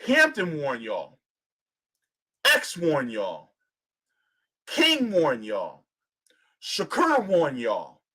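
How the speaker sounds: slow, weighty roll call; slow litany. Real, not acted. Host to audience, slow solemn roll call. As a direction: let each name land slowly.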